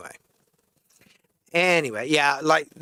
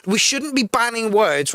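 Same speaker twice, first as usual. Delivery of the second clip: normal talking voice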